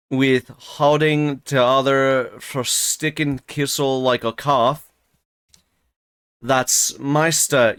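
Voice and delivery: put-on slow, halting broad Yorkshire old servant; slow struggling rustic dialect reading; Joseph's thick dialect. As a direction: read the old servant's dialect, stumbling slowly.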